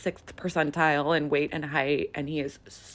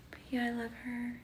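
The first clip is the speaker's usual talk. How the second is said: higher soft whisper; 7 semitones higher, 7 dB quieter than usual. Real, not acted. Showing the finished hair, whispering fondly. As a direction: breathe it adoringly.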